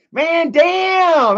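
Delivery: high, loud hammed-up "Man, damn"; mock shout. Streamer jokingly shouts over a viewer's closed store. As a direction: blast "damn" as a jokey outburst.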